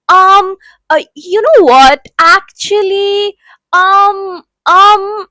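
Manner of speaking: lightly mocking hesitant rambler, drawn-out ums; filler-word talker. Shown as a demonstration. Coach mocks people who talk in filler words, hesitant and stalling. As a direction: stall on every um like a lost speaker. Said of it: "A lot of people talk like this".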